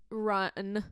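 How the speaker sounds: ordinary delivery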